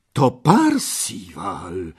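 drawn-out cry of recognition; sudden exclamation. A narrator's reading. Gawain suddenly recognises Parsival, surprised exclamation. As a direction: blurt out who it is. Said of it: "wykrzyknął nagle Gawain".

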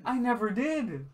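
high, drawn-out protest; real exasperated squabbling; indignant denial. Real, not acted. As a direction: stretch the denial out, pitched high. Heated denial in argument.

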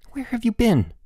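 high, sharp and tense; snapping. Acted. Boots snapping at his late friend after waiting scared. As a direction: snap at him, relieved and cross. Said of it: "Boots snapped".

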